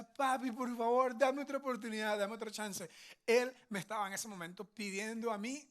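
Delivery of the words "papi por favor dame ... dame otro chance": imitating a pleading child. Son begs dad for another chance, pleading child voice. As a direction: plead for one more chance. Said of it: "Me decía".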